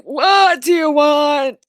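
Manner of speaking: put-on high slow old man shout; old wizard snapping. Wizard demanding what the visitors want, shouting. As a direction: demand it, suspicious.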